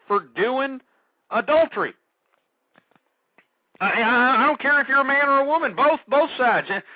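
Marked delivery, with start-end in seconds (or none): 3.8-6.7 heated outburst. Real, not acted. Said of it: "if I sound a little upset".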